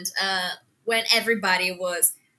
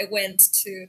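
ordinary talk

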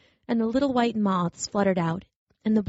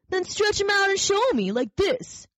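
high, lively daring rabbit voice; challenging wild rabbit; 12 semitones higher than usual. Acted. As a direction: dare him to show it.

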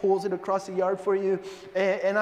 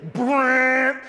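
mower brrr noise; 4 semitones higher, 6 dB louder than usual. Retold or imitated.

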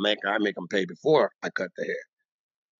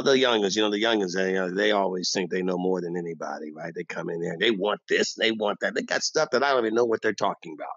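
ordinary talk, nothing marked

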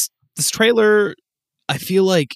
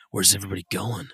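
quoting Leon; 6 semitones lower, 6 dB quieter than usual. Retold or imitated. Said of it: "And then he just goes".

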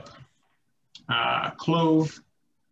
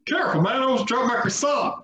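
high-pitched mock scream; hammed-up high shouting; screamed meme quote; 10 semitones higher than usual. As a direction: yelp it out like the Vine. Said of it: "You screamed at the beginning".

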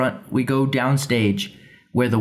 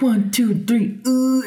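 fake straining; 11 semitones higher, slower than usual. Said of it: "we're like".

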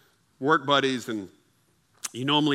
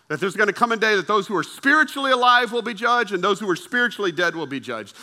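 emphatic raised-voice sermon delivery; forceful preaching; 7 semitones higher, 6 dB louder than usual. Real, not acted. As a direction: declare it to the whole room, stretching key words.

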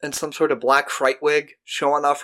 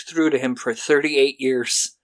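normal talking voice

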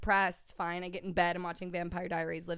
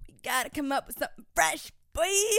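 pleading that climbs high and loud on please; begging whine rising to a squeal; whiny plea; 6 semitones higher, 3 dB louder than usual. Retold or imitated. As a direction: whine at him to change it up. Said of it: "I'm like".

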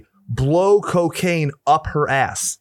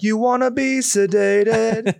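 high, slow drawn-out taunt; joke character quote; 8 semitones higher, slower than usual. Retold or imitated. Riffing on [name] being sedated, high slow mock crooning. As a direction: stretch it out in a high put-on voice. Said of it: "that's when [name] has left the building".

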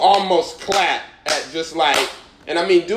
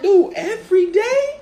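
high, rising mock protest; exasperated disbelief. Freshman to the dude picking on him, exasperated. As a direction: pitch it way up, baffled and fed up.

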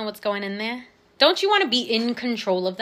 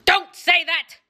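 high, sharp mock scolding; 10 semitones higher, 6 dB louder, slower than usual. Quoting herself telling a friend off, high mock scold. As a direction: brush off a friend's comment with a teasing scold. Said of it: "or not just when I say".

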